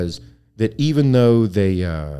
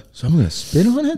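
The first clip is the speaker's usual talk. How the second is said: put-on baffled kid voice; 10 semitones higher than usual. His younger self, baffled.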